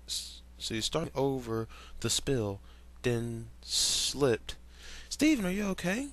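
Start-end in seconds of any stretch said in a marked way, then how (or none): 5.1-6.1 worried. Acted. Pearl worried call.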